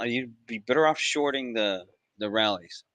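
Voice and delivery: ordinary delivery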